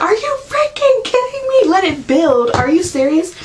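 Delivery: very high, indignant disbelief; retold outrage at being told to wait; incredulous outrage. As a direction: squeal it back at the doctor in disbelief. Mocking doctor's let it build.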